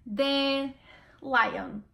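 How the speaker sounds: "The lion" said the English way. Shown as a demonstration. Portuguese speaker demonstrating "The lion" in English. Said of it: "Vou falar em inglês".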